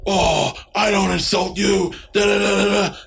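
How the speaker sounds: mild mock yell at the cousin; mock upset yelling. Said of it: "starts yelling, you know, like, caps".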